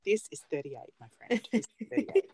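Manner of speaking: normal talking voice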